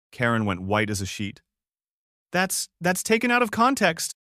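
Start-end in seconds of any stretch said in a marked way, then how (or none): 2.3-4.2 high panicked stammer. A narrator's reading. Karen panics, caught on tape.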